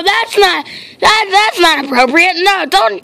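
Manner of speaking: normal talking voice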